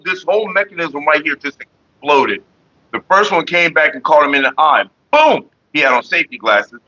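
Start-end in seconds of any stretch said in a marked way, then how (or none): 5.1-5.5 sharp high boom sound; imitated explosion. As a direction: shout the boom like a blast.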